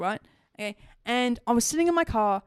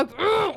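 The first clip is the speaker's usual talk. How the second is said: high, exasperated ugh; frustrated growl bursting out mid-sentence; riled-up groan; 7 semitones higher than usual. Real, not acted. Woman still riled by mean neighbour, exasperated groan. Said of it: "I'm riled up".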